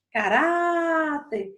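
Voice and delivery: "caráter" with a long "rá"; stretched stressed syllable. Shown as a demonstration. Teacher giving another paroxítona, "rá" of "caráter" stretched out. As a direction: hold the "rá" in "caráter", then fall. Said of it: "Se eu passei mais tempo no rá".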